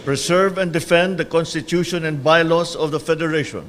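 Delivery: solemn, measured oath-taking; court oath. Acted. Administers the oath of office.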